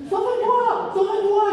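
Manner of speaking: high shouted warning; piglets crying out. Acted. The piglets shout a warning to the wolf, high. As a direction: yell a high alarm to flee. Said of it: "lui crient les deux premiers cochons".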